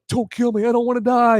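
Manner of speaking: high-pitched mock begging; mock pleading. Strangled man begging his attacker, imitated high pleading. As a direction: beg for your life, imitating a scared victim. Victim begs for his life.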